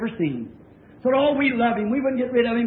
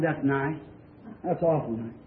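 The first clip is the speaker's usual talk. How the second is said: low, soft, reserved acknowledgment; subdued reply; 10 semitones lower, 7 dB quieter than usual. Retold or imitated. Preacher answers neighbor's praise of children's stories, low and subdued. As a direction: answer quietly, keeping your real opinion back.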